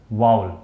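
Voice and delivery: वाउल run together as one low, falling "vaul"; correct pronunciation वाउल. Shown as a demonstration. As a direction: blend वाउल into one smooth syllable. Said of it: "इसको वाउल पढ़ेंगे".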